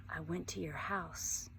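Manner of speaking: whispered, slow and soft; hushed whisper so no one hears. Acted. Josie whispers to Vanessa outside school, hushed. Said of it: "lowering my voice".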